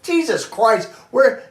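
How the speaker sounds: high, loud startled exclamation; recalling the shock of the stolen set; retold shock. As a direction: blurt it out in disbelief. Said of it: "I was like".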